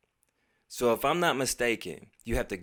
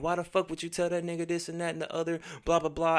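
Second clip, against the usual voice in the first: fast, higher mock rant; mock spazzing out; 6 semitones higher, faster than usual. Friend spazzing out on the phone at his girl, fast mock rant. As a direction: rattle it off like a guy losing it. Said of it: "now he's spazzing out again".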